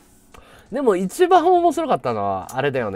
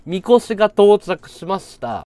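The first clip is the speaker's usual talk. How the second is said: usual speaking voice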